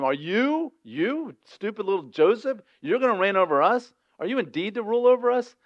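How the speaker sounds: high, scornful jeering at a little brother; mocking brothers. Jealous brothers mocking Joseph's dream in a high jeering voice. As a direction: sneer it down at the dreamer. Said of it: "what they said when he had that dream".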